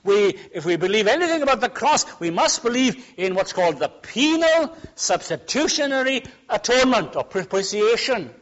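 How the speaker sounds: high, loud, emphatic pulpit delivery; full-force preaching. Acted. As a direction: drive it home to the congregation.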